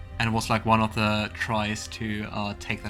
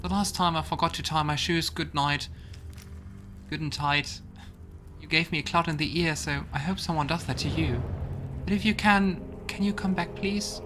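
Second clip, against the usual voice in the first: put-on higher-pitched, hurt little child voice; lost child's letter; 6 semitones higher than usual. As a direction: read it as a child pleading for Mom. Reads a child's letter to Mom.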